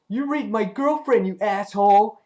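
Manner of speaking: high-pitched, hammed-up outrage; acted angry accusation. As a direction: hurl it like a squabbling kid. Acting out Dave's angry accusation.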